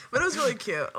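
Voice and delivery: words through leftover giggles; laughing. Real, not acted. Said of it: "Try not to laugh".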